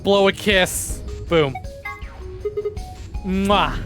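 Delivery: usual speaking voice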